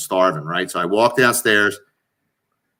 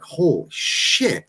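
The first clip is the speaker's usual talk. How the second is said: mock amazement; 5 semitones higher, much slower than usual. Amazed at the French fries.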